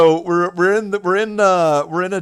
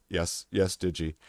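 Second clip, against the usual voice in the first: weary reply; 8 semitones lower, 9 dB quieter than usual. Retold or imitated. Ads answering, patient.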